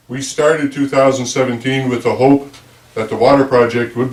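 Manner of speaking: reading a prepared address aloud; measured, official public statement; formal speech. Acted. Reads the year-end address.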